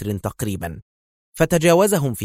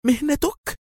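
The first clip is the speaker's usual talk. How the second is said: horrified echo; slower than usual. A narrator's reading. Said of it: "وهي تردد في ارتياع".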